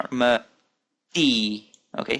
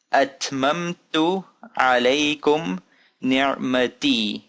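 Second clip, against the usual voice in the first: measured recitation, syllables joined up more; bit faster reading; much slower than usual. Shown as a demonstration. Teacher repeating the sentence for learners, a bit faster. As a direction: still drawn out, but flowing word to word. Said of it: "Let's go a bit faster".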